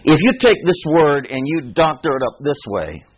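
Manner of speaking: normal talking voice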